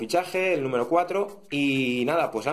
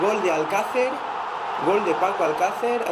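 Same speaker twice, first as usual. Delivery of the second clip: goal celebration shout; 5 semitones higher, 3 dB louder than usual. Acted. Goal shout opening a pack.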